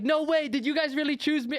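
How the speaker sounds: high, incredulous imitation; flustered disbelief. Restaurant guy learning diners picked his place, flustered disbelief. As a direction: play the amazed restaurant guy. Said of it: "this dude's so embarrassed".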